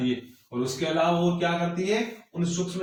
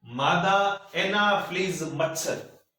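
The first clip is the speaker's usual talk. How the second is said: dictating; 3 semitones higher, slower than usual. Shown as a demonstration. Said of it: "नई line से लिखो".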